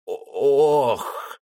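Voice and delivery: pained cry breaking into a weak groan; a last moan full of suffering; death groan. Acted. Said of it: "Последний стон донёсся". (предсмертный стон)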